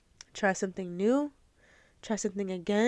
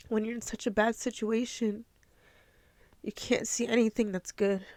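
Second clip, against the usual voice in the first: tearful; 3 dB quieter than usual. Real, not acted. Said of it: "I'm a crybaby".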